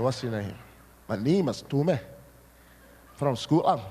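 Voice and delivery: put-on light Scottish accent as a boy; hint of a Glasgow kid's Scottish accent. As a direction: lightly voice a child's Scottish brogue. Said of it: "I had a Scottish accent".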